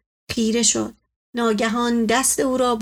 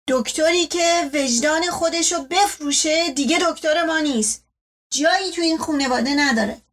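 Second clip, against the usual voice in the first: shouting him out; 5 semitones higher than usual. Acted. Daijan ordering the doctor out of his house, indignant. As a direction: throw the traitor doctor out with raised pitch. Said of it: "فریاد زد".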